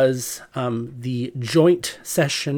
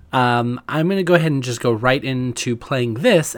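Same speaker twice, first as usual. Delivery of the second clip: ordinary talk, nothing marked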